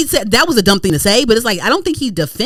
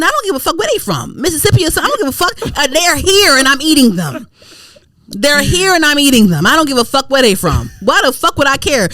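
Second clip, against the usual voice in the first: high-pitched, worked-up disbelief; indignant raised-pitch outburst; exasperated rant; 5 semitones higher than usual. Retold or imitated. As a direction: rant it up high, utterly fed up. Acted exasperated rant at commenters.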